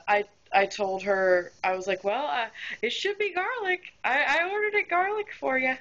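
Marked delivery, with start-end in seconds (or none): 2.0-5.7 high, sugary customer-service voice; sweet and helpful, hiding annoyance; polite server voice. Retold or imitated. Server answering a complaining customer, sugary and high.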